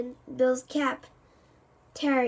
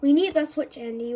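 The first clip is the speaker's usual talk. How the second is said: plain reading voice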